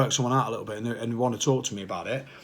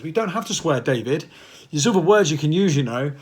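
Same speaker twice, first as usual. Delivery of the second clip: mock self-scolding; 4 semitones higher, 5 dB louder than usual. Scolding himself for wanting to swear.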